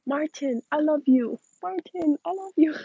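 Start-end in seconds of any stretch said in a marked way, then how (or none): 1.6-2.7 second take pitched up high, sing-song; higher cute repeat. Shown as a demonstration. Chat asks again, she repeats it pitched high. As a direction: lift it way up and make it sweet. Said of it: "Can you say".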